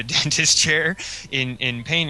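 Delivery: ordinary talk, nothing marked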